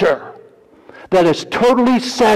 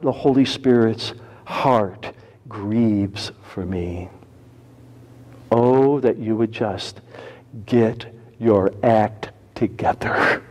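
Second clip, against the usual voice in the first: low, soft, grieving; hushed sorrow; sorrowful; 5 semitones lower, 5 dB quieter, slower than usual. Acted. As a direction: sigh it like a heartbroken parent. Grieving voice of God.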